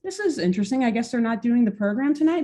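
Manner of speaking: a listener shrugging at the radio; casual listener. Retold or imitated. Listener reacting to radio music.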